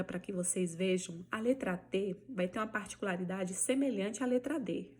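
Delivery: normal talking voice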